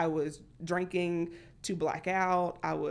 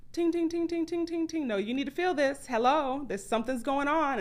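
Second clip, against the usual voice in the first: high ringing alarm voice; playful voiced body alarm; body's alarm; 9 semitones higher, 3 dB louder than usual. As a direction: chime like a warning bell.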